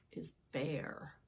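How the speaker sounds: hushed storyteller whisper; soft dramatic whisper of is bare; whispered reveal. A narrator's reading. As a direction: whisper it, dropping low. Reveal of empty cupboard.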